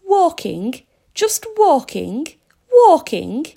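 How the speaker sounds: high, loud disbelieving questions; incredulous questioning. A narrator's reading. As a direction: repeat "Walking?" with rising disbelief. Police car doubts his answer.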